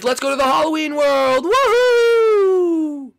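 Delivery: high, loud cry of adventure; excited whoop. Pretend. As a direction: launch into it, rising to a long whoop. Launches into the new world.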